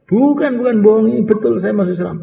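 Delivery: high, eager voice of a convert insisting he means it; a lightly voiced earnest young convert; eager protest.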